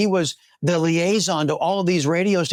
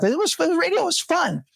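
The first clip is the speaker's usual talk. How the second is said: nostalgic exclamation; 12 semitones higher than usual. Real, not acted. Nostalgic exclamation about radio.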